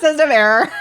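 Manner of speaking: laughing robot voice, high and loud; glitching robot, giggling through it; rusty robot. Pretend. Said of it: "joking robot bit".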